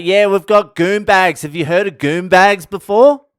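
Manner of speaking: hammed-up loud tourist, pleased with himself; imitating the brother. Aussie brother asking the young tour guide about goon bags, loud and mocking. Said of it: "He's like".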